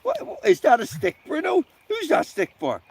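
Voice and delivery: pet talk. Real, not acted. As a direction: tease the dog about the stick. Playful high talk to dog.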